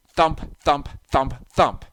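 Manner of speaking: loud heavy thump; thumping noise. A narrator's reading. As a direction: pound it out. Monster at the door.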